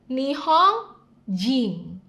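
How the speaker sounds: Nihon jing said slowly, stress on Nihon; slow model. Shown as a demonstration. Teacher modelling the word for Japanese citizen, slowly. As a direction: stretch Nihon jing for learners. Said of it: "Warga negara Jepang".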